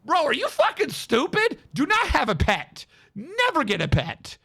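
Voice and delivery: high-pitched mock scolding; imitated exasperated yell; retold yelling. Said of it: "I was yelling".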